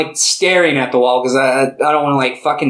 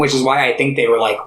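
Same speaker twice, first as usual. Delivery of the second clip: normal talking voice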